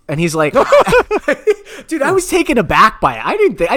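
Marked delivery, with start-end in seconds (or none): 0.6-1.8 high-pitched burst of laughter. Real, not acted. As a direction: break into a squeaky laugh. Laughing retelling call.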